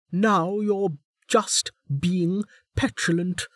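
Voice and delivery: high, slow scolding warden; prison guard. A narrator's reading. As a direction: chide a sulking prisoner. Warden voiced.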